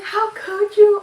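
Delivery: hammed-up tearful cry at dad; mock wail. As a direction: wail it like a betrayed teen. Crying at dad.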